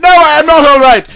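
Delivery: high, loud anguished answer; loud distress. Acted. Hurt Lorna crying out to Legolas, in distress. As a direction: cry it out in pain.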